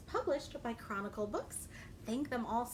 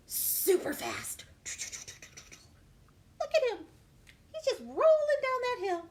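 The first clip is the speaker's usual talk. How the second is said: high, louder delight; excited storyteller; 11 semitones higher, 5 dB louder than usual. Acted. Reader showing kids the rolling pea, delighted.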